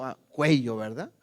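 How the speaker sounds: ordinary talk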